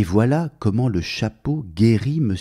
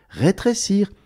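playful echo; 11 semitones higher than usual. A narrator's reading. Narrator to children, "rétrécir" echoed high and playful. Repeated for effect.

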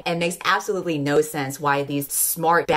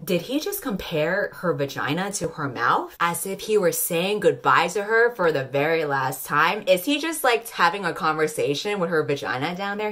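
ordinary talk

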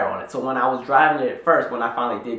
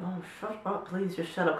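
much softer, pleading under the breath; hushed plea; 5 semitones higher, 10 dB quieter than usual. Retold or imitated. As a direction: beg her quietly to stop. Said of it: "I'm looking at her like".

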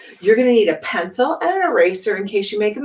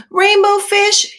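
much higher, louder slow call; little fish calling; 6 dB louder, slower than usual. Acted. Little blue fish calling after Rainbow Fish, high and loud. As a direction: call after him, pleading. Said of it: "he called".

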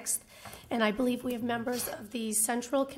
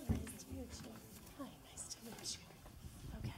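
normal talking voice

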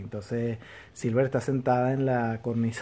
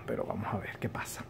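hushed whispered aside; 3 semitones lower, 10 dB quieter than usual. Real, not acted. Vlogger teases the next episode to viewers, whispered. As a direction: drop to a secretive whisper.

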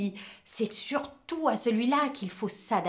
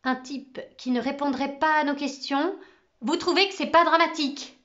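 rising, louder, anguished pleading; Camo's rising anguish at losing his teacher; anguished outburst; 6 semitones higher, 7 dB louder than usual. Acted. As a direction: panic climbing into a cry. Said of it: "Une telle angoisse dans la voix de Camo".

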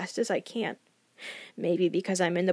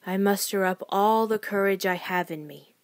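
ordinary delivery

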